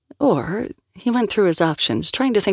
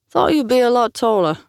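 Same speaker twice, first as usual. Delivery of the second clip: mocking thug; 7 semitones higher than usual. Tattooed thug sizing up Liam, high mocking jeer. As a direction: jeer at him like a disappointed tough. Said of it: "said Snake Tattoo".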